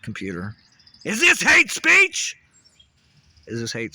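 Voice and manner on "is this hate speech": slow, much higher, much louder cry; screamed demo. Shown as a demonstration. Ranter tests which take goes through to the computer, screaming. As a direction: scream "this" out long and high. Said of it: "What goes through better".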